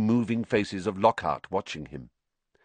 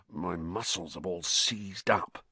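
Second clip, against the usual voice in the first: groaned; 3 semitones lower, 6 dB quieter than usual. Acted. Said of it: "he groaned".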